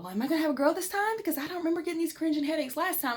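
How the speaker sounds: excited inner wondering, fast and high; wondering thought. Retold or imitated. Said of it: "So I thought".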